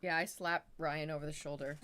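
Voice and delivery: usual speaking voice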